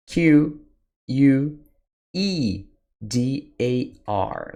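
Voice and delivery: Q U E D A R spelled out as separate letters. Shown as a demonstration. Teacher spelling quedar for students, letter by letter. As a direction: spell quedar slowly, one letter at a time. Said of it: "Quedar is spelled".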